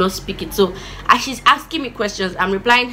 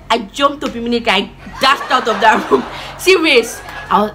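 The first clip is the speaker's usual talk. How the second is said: ordinary talk, nothing marked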